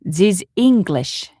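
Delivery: put-on slow German-accented waiter. Waiter grumbling about the English, German accent. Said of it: "easier to do a German accent".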